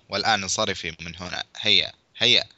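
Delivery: plain reading voice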